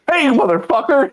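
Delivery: high, laughing mock outrage; mock angry coworker. Coworker reacting to the insult, laughing mock outrage. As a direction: yell it like a pal who got burned. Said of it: "He fucking was like".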